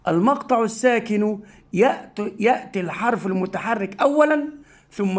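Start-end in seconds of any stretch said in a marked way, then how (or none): none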